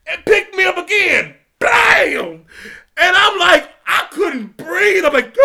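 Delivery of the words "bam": loud, high "Boom!" punched out; slam sound effect. Retold or imitated. Describing hitting the mat, loud mock impact sound. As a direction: bark the impact like a crash. Imitating being slammed down.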